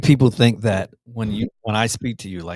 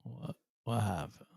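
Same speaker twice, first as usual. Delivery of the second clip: soft prodding; 16 dB quieter than usual. Real, not acted. Host presses co-host softly.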